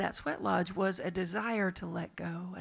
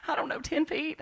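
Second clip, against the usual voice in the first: imitated Southern accent, pitched up; 7 semitones higher than usual. Retelling a phone call from the mountain, imitated Southern accent. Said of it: "I'm real, real Southern".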